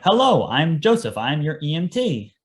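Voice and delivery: mock stilted greeting, pitched high; weird, over-deliberate self-introduction; awkward introduction. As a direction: imitate a stiff, awkward rookie introducing himself. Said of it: "awkward, awkward conversation between me and them".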